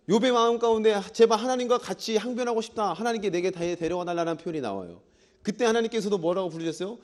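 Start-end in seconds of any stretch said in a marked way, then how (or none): none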